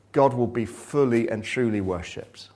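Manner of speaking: usual speaking voice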